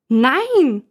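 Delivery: loud, high furious shout. Acted. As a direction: scream the refusal. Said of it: "schreit sie so laut". (lauter, hoher wütender Schrei)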